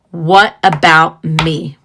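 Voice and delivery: slow, drawn-out "What about me?" with weight on every word; emphasis. Shown as a demonstration. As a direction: lean hard on "What about me?", slow and deliberate. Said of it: "had to put some emphasis there".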